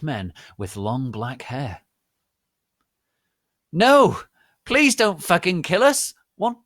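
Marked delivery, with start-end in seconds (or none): 3.7-6.6 high, loud desperate plea; screaming pleas; pleading. Acted. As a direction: beg for your life. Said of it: "they pleaded".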